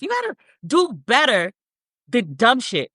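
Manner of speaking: normal talking voice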